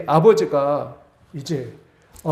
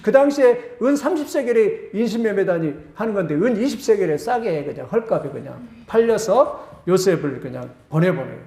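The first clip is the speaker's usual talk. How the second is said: ordinary delivery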